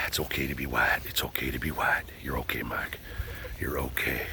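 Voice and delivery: soft, low self-soothing whisper; calming whisper. Real, not acted. Calming himself down after getting emotional, soft whisper. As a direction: whisper reassurance to yourself. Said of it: "let me do some deep breathing".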